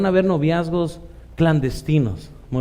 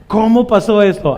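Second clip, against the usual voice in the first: shocked question; 6 semitones higher, 8 dB louder than usual. Retold or imitated. Shocked church member asks.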